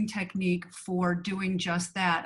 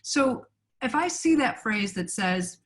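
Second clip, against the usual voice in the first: ordinary talk, nothing marked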